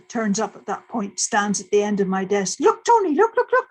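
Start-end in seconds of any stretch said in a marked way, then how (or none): 2.6-3.7 much higher, louder, eager pleading to look; bursting with the news, calling him over; excited. Retold or imitated. Student urging her supervisor to see the charts, excited. Showing Tony the new pulsars.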